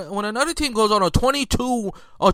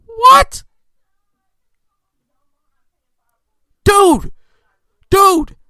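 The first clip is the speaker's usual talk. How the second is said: outraged shout; 11 semitones higher, 8 dB louder, much slower than usual. Real, not acted. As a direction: yell it, high and loud. Exploding at the player.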